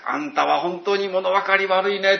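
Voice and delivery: mock scolding. Preacher imagining someone scolding the congregation, mock scolding. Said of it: "って言われたらどうしますか".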